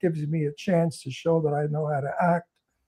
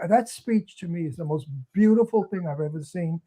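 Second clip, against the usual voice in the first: usual speaking voice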